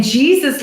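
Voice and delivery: "Jesus" as Canadian slang "Geez", punchy. Retold or imitated. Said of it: "like a real Canadian thing".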